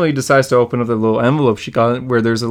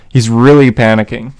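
louder burst, leaning into the mic; too close to the mic; 6 dB louder than usual. Real, not acted. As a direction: lean in and get suddenly loud. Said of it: "oh, I just leaned into my mic there".

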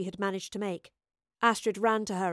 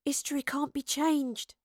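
higher, tense rebuke held down in volume; hissed retort; 5 semitones higher than usual. A narrator's reading. Astrid contradicting the professor, hissed. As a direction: hiss it at an opponent. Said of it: "Astrid hissed at the professor".

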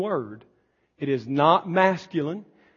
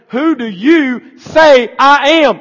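loud, slow, higher searching question; Jesus asking; 9 semitones higher, 12 dB louder, slower than usual. Acted. Jesus challenging the apostles, loud and slow. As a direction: call it out slowly to the apostles.

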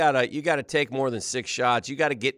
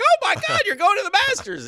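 much higher, thrilled shriek; excited squeal; 4 dB louder than usual. Retold or imitated. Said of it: "is like".

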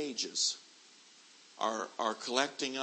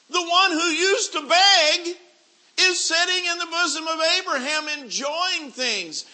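high, loud hellfire sermon voice; full-force preacher warning of hell; fiery preaching; 13 dB louder than usual. Acted. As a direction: thunder it down from the pulpit.

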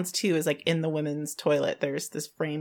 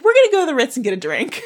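excited; 7 semitones higher, 6 dB louder, faster than usual. Retold or imitated. Retold eager plan, fast and high.

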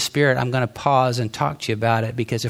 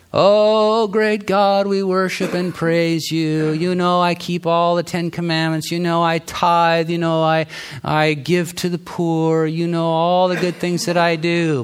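higher, showy pious voice praising himself to God; mock Pharisee prayer; 5 semitones higher than usual. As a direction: ham up a self-righteous Pharisee boasting in prayer.

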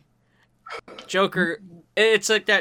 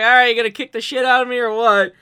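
Joker, louder and fast, daring Batman to fight; loud Joker challenge; 6 semitones higher, 8 dB louder, faster than usual. Retold or imitated. Joker taunting Batman to get it over with, loud and fast. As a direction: hurry him into the fight, loud. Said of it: "Then he's just like".

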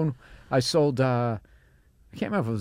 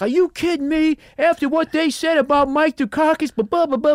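very high, loud outraged rant; mock furious caller; 7 dB louder than usual. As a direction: rant like an offended voter. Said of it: "this guy flipped out about politics".

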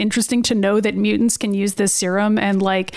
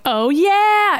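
high-pitched, acted "oh yeah"; mock sudden realization; 9 semitones higher than usual. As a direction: play a character who just remembered the obvious. Acting Kurt's sudden realization.

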